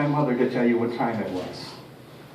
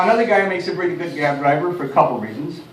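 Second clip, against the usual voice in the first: ordinary delivery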